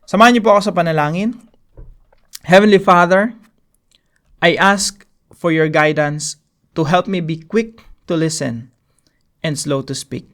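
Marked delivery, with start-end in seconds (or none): 2.4-10.3 hushed, reverent closing prayer. Acted.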